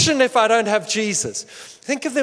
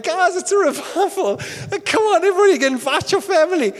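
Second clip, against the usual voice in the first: much higher, laughing mock cheerleader call; mock hype; 9 semitones higher, 4 dB louder than usual. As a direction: poke fun at a hyped-up revival host. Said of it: "they weren't coming into a worship time going".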